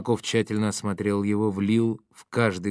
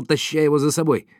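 usual speaking voice

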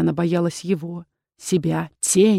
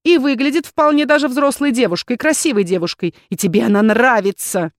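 higher, louder jealous retort; strained scorn; 5 semitones higher, 5 dB louder than usual. A narrator's reading. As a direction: throw it at him with forced scorn. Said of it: "Валерита натянуто расхохоталась".

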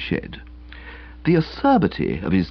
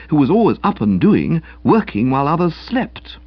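ordinary delivery

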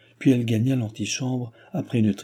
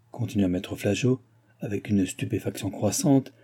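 plain reading voice

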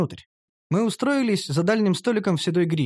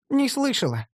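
put-on higher girlish reply; elf girl voice; 9 semitones higher than usual. Elf girl says she never heard such names, in a higher voice. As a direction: answer lightly as the elf.

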